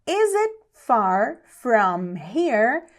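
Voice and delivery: very slow, word-by-word question; slow teaching pace. Shown as a demonstration. English teacher models asking about distance, very slowly. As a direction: stretch each word, pausing between them.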